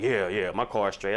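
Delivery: low, slow mock brother; lazy low reassurance, stretched; brother's voice. Man mimicking his brother brushing him off, low slow voice. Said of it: "He was like".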